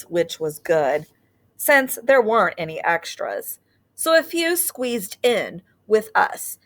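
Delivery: put-on gentle storybook read-aloud for young listeners; teacher reading a picture book to her class; reading to children. A narrator's reading. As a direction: read it like story time on the rug.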